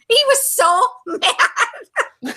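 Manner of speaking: slowed, breaking into laughter mid-line; laughing. Real, not acted. Recalling Brett's reaction to the joke, laughing. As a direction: laugh through the words.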